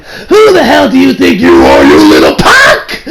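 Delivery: slow, loud shouted thug threat; overacted anime dub. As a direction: yell it like a furious anime henchman. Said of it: "That's about what he sounded like".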